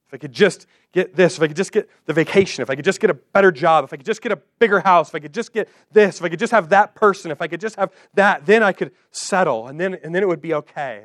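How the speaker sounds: a frantic wish list climbing in pitch; mounting craving. Pretend. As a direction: build the wanting until it nearly bursts. Voicing an endless list of wants.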